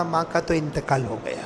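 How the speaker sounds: low, very soft grave news; hushed announcement. Acted. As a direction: break the death news quietly. Neighbours announce mother's death. (دھیمی آواز میں موت کی خبر)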